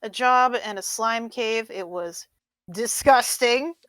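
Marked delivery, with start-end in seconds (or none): 2.7-3.7 disgusted. Acted. Player voices character's disgust at slime job.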